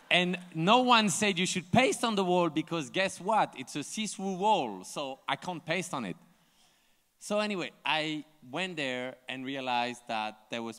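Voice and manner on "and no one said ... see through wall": higher and louder, building the punchline; excited joke. Real, not acted. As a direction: land the joke with a big lift. Joking about the border wall.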